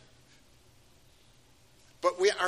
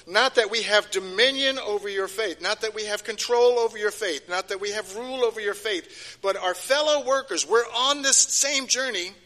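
louder and higher, slowing at the end; building preaching; 4 semitones higher, 5 dB louder than usual. Acted. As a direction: drive it home from the pulpit.

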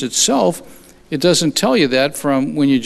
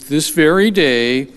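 ordinary delivery

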